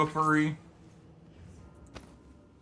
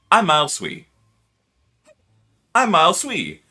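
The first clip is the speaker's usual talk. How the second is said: slightly louder practice takes; intro voice retakes; 4 semitones higher, 3 dB louder than usual. Shown as a demonstration. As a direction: try the intro line once more. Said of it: "take a couple deep breaths and go".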